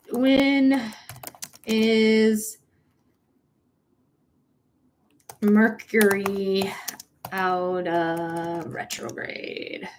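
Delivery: slow drawn-out words while typing a search; typing aloud. Real, not acted. Streamer typing a search to her viewers, slow and drawn out. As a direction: spell out the search one slow word at a time.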